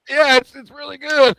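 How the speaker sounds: pained squeaky voice forcing out praise; strained high voice. Acted. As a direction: choke out the compliment through burning pain. Mocking forced praise, burning mouth.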